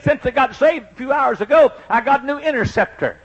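high, loud imitated southern drawl; little southern drawl. New convert telling church she got saved, imitated southern drawl. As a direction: announce it as her, in southern drawl. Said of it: "in a little southern drawl".

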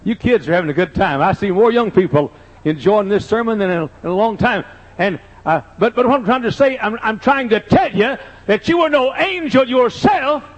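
preaching. Acted. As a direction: preach it out to the crowd. Preaching at full force.

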